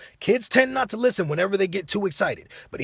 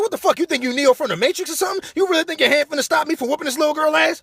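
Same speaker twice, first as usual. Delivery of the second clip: high, loud incredulous rant; worked-up, high-pitched challenge; acted outrage; 12 semitones higher, 5 dB louder than usual. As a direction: confront them, high and indignant.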